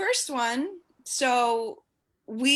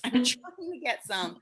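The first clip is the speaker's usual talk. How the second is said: normal talking voice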